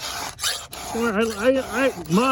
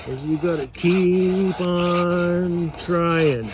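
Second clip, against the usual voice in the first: drawn-out, deliberate advice; slow it right down to drive the point home; earnest, slowed-down advice; slow emphasis; much slower than usual. Real, not acted. Stressing life advice to folks.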